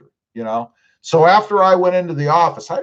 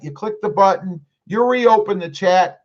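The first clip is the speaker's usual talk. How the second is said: ordinary talk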